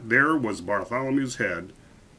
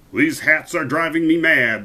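louder, raised exasperated king; ringing royal shout; 5 semitones higher, 7 dB louder than usual. A narrator's reading. King maddened by the hats, ringing shout. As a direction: let it ring through the palace. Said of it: "the king's voice rang".

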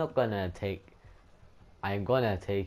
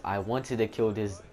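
ordinary talk

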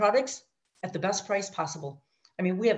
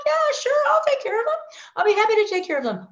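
much higher, louder, gushing agreement; eager yes; 6 dB louder, faster than usual. Retold or imitated. Accepting the sponsor's customers, high and gushing. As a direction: gush yes like a thrilled newbie.

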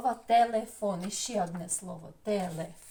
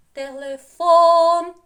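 телефон slow and loud, higher and punched on фон; stress on the last syllable; 7 semitones higher, 14 dB louder, much slower than usual. Shown as a demonstration. Teacher shows pupils where the stress falls in телефон. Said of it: "Ставимо наголос".

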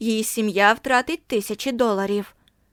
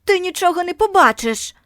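much higher, loud spiteful taunt; jeering shout; 8 semitones higher, 6 dB louder than usual. A narrator's reading. Bully taunting Margo, high loud jeer. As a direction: spit the threat at her.

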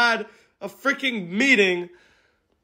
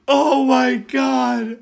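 usual speaking voice

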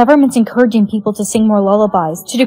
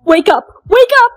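rising frantic yells; shouting wake up; 11 semitones higher, 4 dB louder than usual. Acted. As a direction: yell to wake them. Shaking someone awake.

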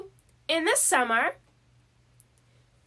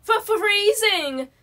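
shivering, teeth knocking; chattering teeth; 5 semitones higher than usual. A narrator's reading. Cold Mouse answering Gus, teeth chattering. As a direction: stammer it through chattering teeth. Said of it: "with chattering teeth".

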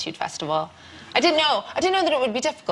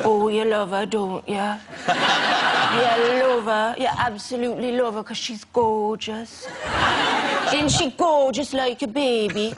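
put-on a light, unconvincing Geordie accent. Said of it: "I can't do a Geordie accent".

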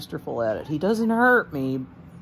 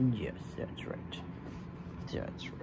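whisper; 10 semitones lower, 16 dB quieter than usual. Real, not acted. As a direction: soothe him in a hush. Soothing the pig quietly.

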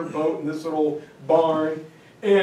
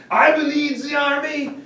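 put-on higher, lightly French-accented pronouncement; hint of French general; 7 semitones higher than usual. French general telling Eisenhower he leads, light French accent. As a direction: state it grandly as his right. Giraud demands command.

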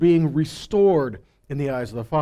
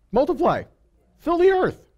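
mock divine command, pitched much higher; God commanding; 10 semitones higher than usual. Preacher voicing God telling people to fill the earth, mock commanding. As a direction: call it out like God giving an order. God's command quoted in sermon.

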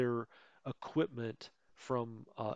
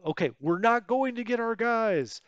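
laughing, higher and louder; 10 semitones higher, 10 dB louder than usual. Real, not acted. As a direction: blurt it out through a laugh. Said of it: "I got you all worked up".

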